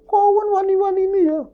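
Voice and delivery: high uncanny spirit voice; eerie spirit voice. Retold or imitated. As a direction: voice the ghost as something not human. Eerie creature voice in story.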